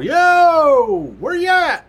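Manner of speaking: calling out loud and high into the dark; a real shout, high and loud; retold yell. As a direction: holler to find the missing troopers. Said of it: "So I give a yell".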